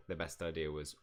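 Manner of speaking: ordinary talk, nothing marked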